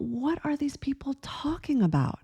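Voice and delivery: incredulous thought. Retold or imitated. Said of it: "I thought that they were absolutely out of their minds".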